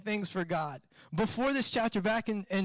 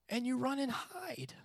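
hushed, higher, in disbelief; incredulous whisper; 4 semitones higher, 6 dB quieter than usual. Acted. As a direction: drop to a stunned whisper. Incredulous at Elijah fleeing after triumphs.

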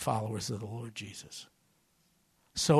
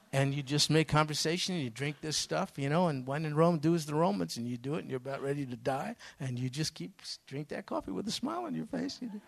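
usual speaking voice

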